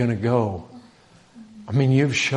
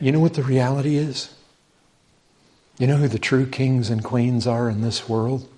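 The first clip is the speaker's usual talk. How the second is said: normal talking voice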